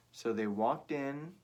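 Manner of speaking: plain reading voice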